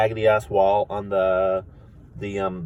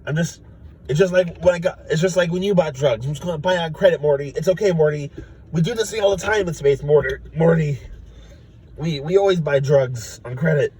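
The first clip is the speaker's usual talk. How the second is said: lightly voiced Rick; 7 semitones higher than usual. Rick reassuring Morty, lightly raised Rick voice. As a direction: brush off Morty's worry like Rick. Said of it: "He's like".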